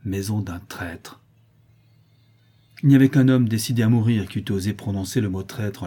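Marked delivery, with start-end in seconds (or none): 0.1-1.2 slow, quiet condemnation; spat-out contempt. A narrator's reading. Prisoner turning back to spit on the doorstep, slow contempt. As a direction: let the insult fall slowly and softly. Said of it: "il cracha sur le seuil en disant".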